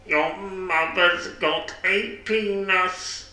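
high, slow, stilted voice; deadpan high character voice; odd musician character. Acted. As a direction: drag it out in a high put-on voice. Odd musician character blurts insult.

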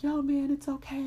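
high, gentle little teddy bear voice; the teddy soothing the boy in a high voice; Teddy's voice. Acted. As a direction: comfort him in a high toy-bear voice. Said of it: "So Teddy is like".

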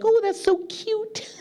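very high, drawn-out falsetto gush; mock cooing. Americans react to him saying two Kings, mock cooing. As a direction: coo slowly in a squeaky imitation.

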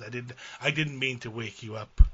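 usual speaking voice

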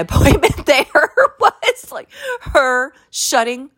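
breaks into a high laugh mid-sentence. Real, not acted. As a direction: laugh through the words. Laughing at her shutting up.

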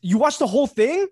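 can't believe you sat through it; incredulous. Real, not acted. Friend shocked his buddy watched the whole musical. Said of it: "incredulous at friend".

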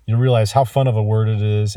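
ordinary talk

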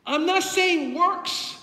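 loud, building pulpit declarations; preaching. Real, not acted. Preacher tells congregation which truth frees, forceful preaching. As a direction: drive each phrase home to the congregation.

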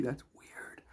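breathy whispered aside; whispered joking aside. Real, not acted. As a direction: drop to a hushed whisper. Aside after a joke.

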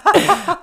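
loud, high burst of laughing; burst of laughter. Real, not acted. Co-host cracks up during her confession, loud high laugh.

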